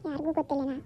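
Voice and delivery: low, soft scheming soliloquy; menacing vow. Acted. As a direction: vow revenge quietly to yourself, after a laugh. Schemer vows revenge alone after laughing. (ತಗ್ಗಿದ ದನಿಯಲ್ಲಿ ಸೇಡಿನ ಶಪಥ)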